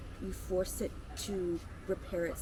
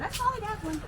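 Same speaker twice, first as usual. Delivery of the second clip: high, amused grandma voice; a light high-pitched grandma impression; imitating grandma; 12 semitones higher than usual. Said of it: "Grandma will laugh and she said".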